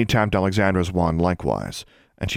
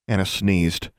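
ordinary delivery, nothing marked

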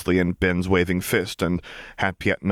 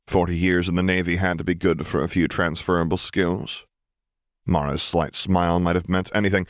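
plain reading voice

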